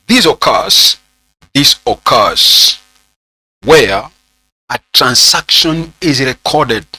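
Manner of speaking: measured, drawn-out words with pauses; slow dictation. Real, not acted. Teacher dictating the note on original entry, slow dictation. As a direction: dictate slowly, phrase by phrase.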